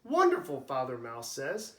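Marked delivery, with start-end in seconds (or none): 0.0-0.6 higher, louder, delighted; bright delighted approval; delighted praise. Acted. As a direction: praise the catch warmly. Father praises son's catch.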